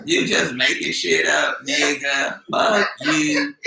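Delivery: drawn-out L.A. twang with attitude; exaggerated L.A. accent, syllables stretched. Shown as a demonstration. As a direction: mimic a remark in slow L.A. twang. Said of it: "Little twang to it".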